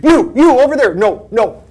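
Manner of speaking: high, loud, fast shouted directions; shouting handler. Retold or imitated. Film crew yelling at elephants to come closer, loud and fast. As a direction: bark orders at the elephants from a distance.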